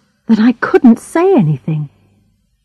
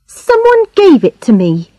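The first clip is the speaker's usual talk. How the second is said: put-on higher-pitched girl's voice, on the defensive; young Charlotte, defensive; 8 semitones higher than usual. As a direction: answer as the girl, pitched up.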